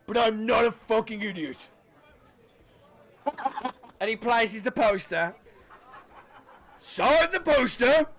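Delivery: gruff innkeeper. Acted. GM voices the innkeeper Doncaster.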